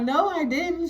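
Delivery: imitating a confused girl; confused denial. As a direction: protest innocence, voice lifted. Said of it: "The girl was confused".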